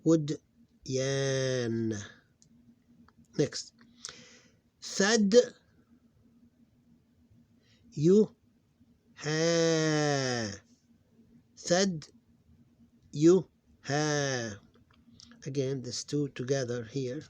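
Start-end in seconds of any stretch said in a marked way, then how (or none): 0.0-1.9 short loud "Would", long-held "yan"; long sound. Shown as a demonstration. Teacher showing consonant and long sound, syllable by syllable. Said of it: "this is long sound". 4.8-14.5 "Thad, you, ha" loud and high, "ha" held; syllable by syllable. Shown as a demonstration. Teacher sounding out a word for students, syllable by syllable.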